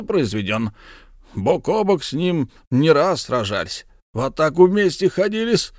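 put-on high, hearty bragging old soldier; boastful count Bekker. Count presents his comrade to his wife, high and proud. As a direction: show off your war comrade.

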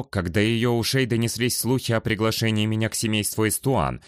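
ordinary delivery, nothing marked

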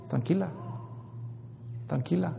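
soothing calm. Acted. Apostle soothing the wife.

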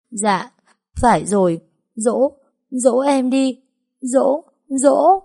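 beg, building to a high whine; sniffling whine. Acted. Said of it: "hích hích rồi trả lời".

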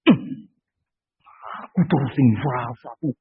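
low, sulky mutter of complaint; sulky grumbling. Retold or imitated. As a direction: grumble like a child told to sweep again. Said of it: "Ambil sapu marah-marah". (menggerutu kesal seperti anak disuruh menyapu)

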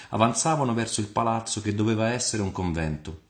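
ordinary delivery

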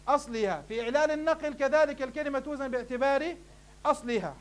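ordinary talk

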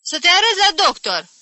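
high, loud, scornful echo; indignant outburst. Acted. Aunt pouncing on the mention of a doctor, indignant. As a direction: jump in outraged at the idea. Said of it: "sări tanti Caterina".

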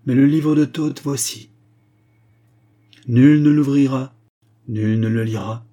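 loud, emphatic solemn decree; forceful pronouncement with weighty pauses; commanding proclamation. A narrator's reading. King forbids the book to all, proclaiming loudly.

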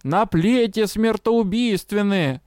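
slow, high crone wail; desperate crone wail. A narrator's reading. Old crone wailing in despair at the crowd, slow high cry. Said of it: "её отчаянный вопль".

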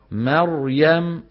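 "مريم" with a thick heavy ra, dragged slow; heavy ra error. Shown as a demonstration. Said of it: "ما بيصير يقول مريم". (راء مفخمة خطأ في "مريم")

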